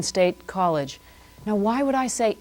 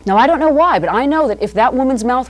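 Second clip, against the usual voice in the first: excited rant; 5 semitones higher, 6 dB louder than usual. Acted. Comic rant about fly.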